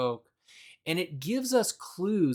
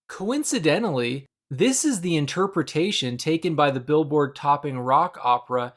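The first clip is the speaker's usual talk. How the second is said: ordinary talk, nothing marked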